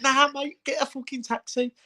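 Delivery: high-pitched firm insistence; laugh-tinged mock order; retold insistence. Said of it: "I said".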